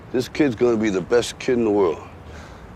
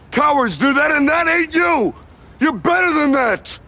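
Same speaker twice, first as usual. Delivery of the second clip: high, forceful fatherly exhortation; fired-up tough love at full voice; impassioned pep talk; 10 semitones higher than usual. Acted. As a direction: drive it home, voice raised and climbing. Father's fierce pep talk to son.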